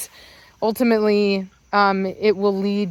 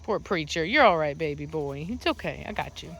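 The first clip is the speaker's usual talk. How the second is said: soothing; 5 semitones lower, 8 dB quieter than usual. Real, not acted. Comforting beaten-up puppy.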